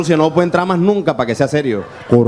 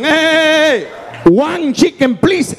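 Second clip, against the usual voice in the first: cocky order; 10 semitones higher, much slower than usual. Retold or imitated. Cocky customer orders chicken.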